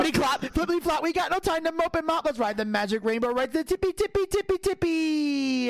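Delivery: high, fast, manic nursery rhyme; sing-song chant climbing to a squeal on tippy. Pretend. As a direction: rattle it off like a wired Mad Hatter. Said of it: "Mad Hatter took a bump of cocaine".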